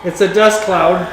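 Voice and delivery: ordinary talk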